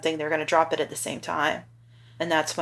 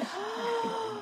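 tearful laugh; 11 dB quieter than usual. Real, not acted. Mom sees son dressed for prom.

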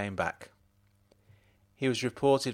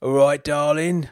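higher, louder tipsy greeting; drunk voice; 5 semitones higher, 9 dB louder than usual. Acted. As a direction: slur it sweetly like a drunk husband. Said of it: "still in his drunk voice".

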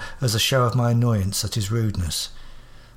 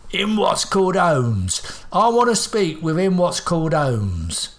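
put-on higher, slow old codger voice, cheeky; impertinent old man; 5 semitones higher, slower than usual. Old man demanding Holmes from the doctor, slow and impertinent. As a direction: croak it out like a rude old man. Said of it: "his impertinent tone".

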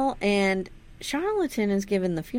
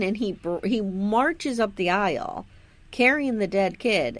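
ordinary talk, nothing marked

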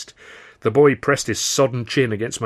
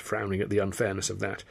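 usual speaking voice

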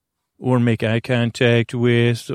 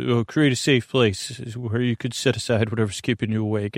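ordinary delivery

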